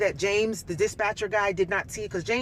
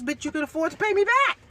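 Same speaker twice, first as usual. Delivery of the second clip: higher, sharp retelling of her comeback; mock retort; 10 semitones higher, 4 dB louder than usual. Woman retelling her comeback to her ex over the money, angry. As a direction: fire the line back at him.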